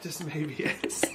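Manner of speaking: soft teasing whisper. Real, not acted. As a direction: breathe it out, sly. Teasing back-and-forth whisper.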